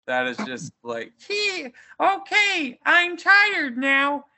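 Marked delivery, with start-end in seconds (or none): 1.2-4.2 put-on high, slow, doddering old man; whiny tired old professor; senile old professor. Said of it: "worst dubbing in the movie has to be the professor".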